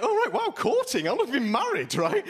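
high, laughing mock astonishment; mock surprise. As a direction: play up the shock at "courting". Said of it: "I was like".

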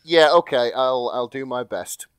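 normal talking voice

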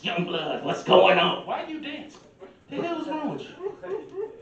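a hint of a cranky old man, rising high by the end; mock old man scolding a dancer; straggly old man voice. Old man scolding a younger dancer, lightly voiced and rising. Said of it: "do I get that straggly voice too".

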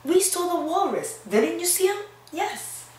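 high, eager insistence; eager agreement. Real, not acted. Teacher tells the children she saw the walrus too, high and eager. Teacher siding with Flynn to kids.

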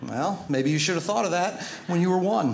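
ordinary delivery

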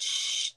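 unvoiced shushing like pouring water; long whispery "shh" sound effect; watering can noise. Shown as a demonstration. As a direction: imitate water sprinkling with a shh.

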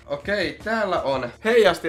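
startled delight; surprised. Real, not acted. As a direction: react as it pops out. Surprised by item in package.

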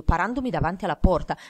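usual speaking voice

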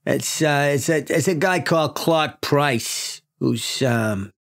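lightly voiced New York boss briefing; brief detectives in a New York accent; matter-of-fact precinct chief; police chief.

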